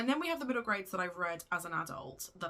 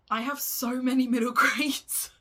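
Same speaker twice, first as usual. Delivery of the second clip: laughing through the confession, louder and higher; 6 semitones higher, 11 dB louder than usual. Real, not acted. To her viewers about her book pile, laughing. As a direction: blurt it out mid-laugh at your own excess.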